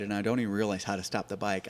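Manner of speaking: ordinary talk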